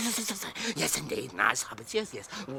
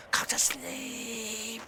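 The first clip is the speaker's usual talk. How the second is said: normal talking voice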